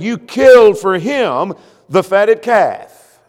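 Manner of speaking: mock resentful whine, slow and loud; envious resentful quote. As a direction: drag it out like a jealous brother. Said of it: "because he said".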